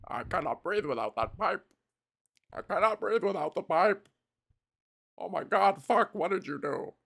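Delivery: hammed-up dying villain, gasping about his pipe. Villain whose breathing pipe broke, hammed-up panic. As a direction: play a panicked villain sealed to his doom.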